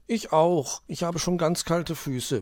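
high-pitched, mild grumble; Hoppe complaining. A narrator's reading. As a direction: complain in a high little character voice. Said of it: "beschwerte sich Hoppe".

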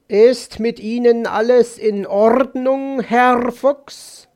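high, loud croaking raven; concerned raven. Acted. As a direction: inquire as a rasping raven. (krächzend fragender Rabe)

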